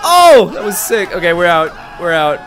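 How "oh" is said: high, loud cry of amazement; startled whoop. Real, not acted. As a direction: burst out with a big shocked oh. Narrow game escape.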